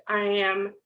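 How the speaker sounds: ordinary talk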